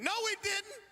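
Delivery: put-on high child's blurt; high kid's voice contradicting mom. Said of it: "I was like".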